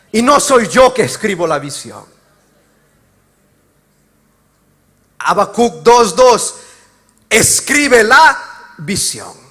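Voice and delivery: loud, rising preacher's call to the congregation; full-force preaching. Acted.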